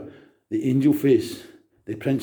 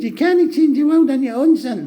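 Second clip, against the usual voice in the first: much higher put-on voice of his mother, talking down to him; mocking mum; 12 semitones higher, 5 dB louder than usual. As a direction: imitate a doubting mum telling her son he can't do it. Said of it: "You said".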